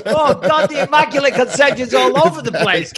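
high laughing outburst at the joke; cracking up, voice leaping high. Real, not acted. Laughing hard as the host jokes about Jesus.

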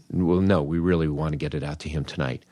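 ordinary talk

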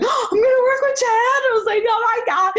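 high, fast, squealing thrill; starstruck excitement. Retold or imitated. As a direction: gush like a starstruck fan.

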